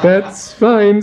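put-on high, hearty old toff; hearty baronet. Baronet heartily greeting Adrian, high hearty voice. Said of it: "said the baronet heartily".

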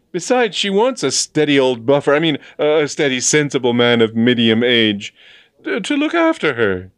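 high, loud, stumbling insistence; agitated young man's plea; flustered arguing. A narrator's reading. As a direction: blurt it, correcting yourself awkwardly. Flustered James argues desperately.

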